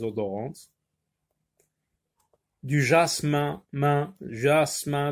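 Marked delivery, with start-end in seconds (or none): 2.6-5.1 jasmin, then main alone, then jasmin, said slowly; teacher pointing out the sound in jasmin; syllable isolated. Shown as a demonstration. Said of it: "donc à la fin du mot, on entend un".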